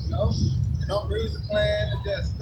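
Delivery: ordinary talk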